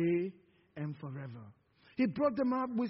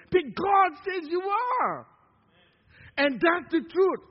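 preaching shout; 5 semitones higher, 5 dB louder than usual. Acted.

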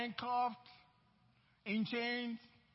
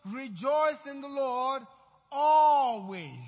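slow, loud preaching shout, louder on always; scripture yelled out; 8 dB louder, slower than usual. Acted. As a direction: yell it back at them, drawing it out. Repeated preached refrain.

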